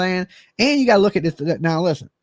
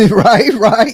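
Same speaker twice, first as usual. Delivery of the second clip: loud laughing agreement; 4 semitones higher, 8 dB louder than usual. Real, not acted. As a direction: laugh it out, much louder.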